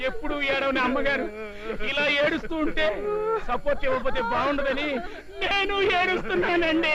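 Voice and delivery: tearful whine rising to a high wail; hammed-up sympathetic bawling; crying. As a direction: weep along out of loyalty. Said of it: "నువ్వు ఎందుకు ఏడుస్తున్నావురా?".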